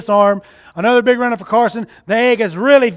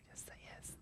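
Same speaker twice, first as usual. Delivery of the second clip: whispering; 37 dB quieter than usual. Real, not acted. Prompting the child on stage.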